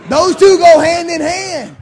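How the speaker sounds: slow, loud, hammering each word; preaching at full force. Real, not acted. Pastor to his church, preaching slow and loud. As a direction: drive it home to the congregation.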